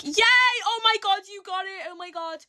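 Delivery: high-pitched cheer dropping to breathless; excited squealing. Retold or imitated. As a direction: shriek with joy for her. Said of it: "one and two are like".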